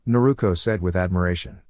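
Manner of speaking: plain reading voice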